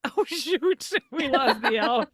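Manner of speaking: high, laughing oh-no; mock dismay. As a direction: play up the panic, laughing. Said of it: "I was like".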